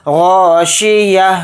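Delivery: غاشیہ ending in a breathy ha; slow demo of ha ending; ha sakin ending. Shown as a demonstration. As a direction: stretch غاشیہ and stop on ha. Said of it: "کس طرح سے اس میں ہا کی آواز آئے گی؟".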